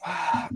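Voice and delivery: long audible breath out; acting out a deep, releasing breath; deep exhale. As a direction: let a big breath go. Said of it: "I took a deep breath".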